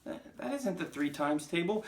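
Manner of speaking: high, stammering schoolboy; nervous protest. Acted. Wilfred querying the times table, nervously. Frightened pupil protests to bully.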